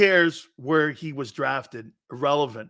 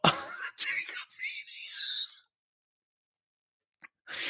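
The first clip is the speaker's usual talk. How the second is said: wheezing with laughter; 10 dB quieter than usual. Real, not acted. Laughs at his own comparison.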